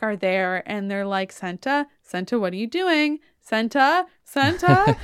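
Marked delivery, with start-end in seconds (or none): 1.3-5.0 mock concern, rising with each name; alarmed calling. Onlookers calling Senta at the cliff, mock alarm. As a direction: call out, getting higher and louder.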